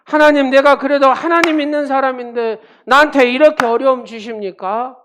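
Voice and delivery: a believer wailing at God over hardship; pleading complaint to God. Retold or imitated. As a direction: cry out as if hurt by God. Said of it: "그런 거하고 똑같은 얘기잖아요".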